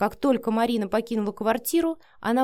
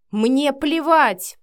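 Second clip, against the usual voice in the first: loud, slow, heavy contempt; growled anger; 6 semitones higher, 8 dB louder, much slower than usual. A narrator's reading. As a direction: throw it out angrily, stretching the words. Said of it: "прорычал Матвей". (сердито, с рыком)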